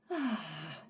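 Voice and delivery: long, soft, low exhale; relaxed sigh. Shown as a demonstration. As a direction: let out a soft sigh of relief. Sighing out to show relaxed state.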